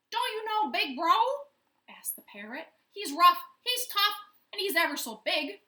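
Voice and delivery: high, loud excited parrot. A narrator's reading. Parrot boasts to chimpanzee about Big Bro, high bird voice. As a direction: chatter it out, bird-like and fast.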